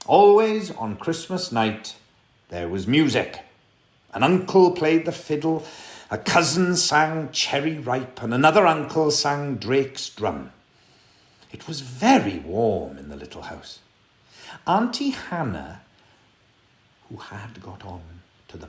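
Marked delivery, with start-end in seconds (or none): none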